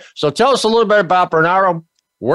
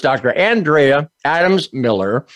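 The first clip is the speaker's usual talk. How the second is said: ordinary talk, nothing marked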